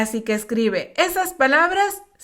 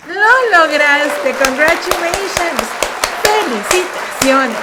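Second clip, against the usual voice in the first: excited praise; 3 semitones higher, 5 dB louder than usual. Real, not acted. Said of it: "praising learners at lesson end".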